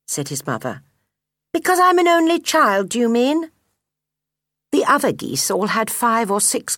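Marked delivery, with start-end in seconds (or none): 1.5-3.5 put-on high, loud childlike voice; young gosling. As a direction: call out like an eager little gosling. Gosling questions his mother.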